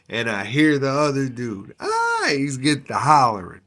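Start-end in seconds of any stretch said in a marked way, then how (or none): none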